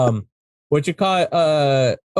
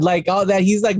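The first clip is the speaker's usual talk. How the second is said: normal talking voice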